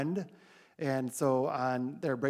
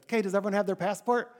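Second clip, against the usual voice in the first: raised, brisk call to the whole van; higher and louder, hurrying everyone; leader's loud passport check; calling out to the group; 7 semitones higher, 4 dB louder, faster than usual. Retold or imitated.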